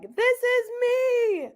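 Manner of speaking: high, slow, gleeful shout; excited kids. Retold or imitated. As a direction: squeal it like a proud seven-year-old. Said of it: "all my students were like".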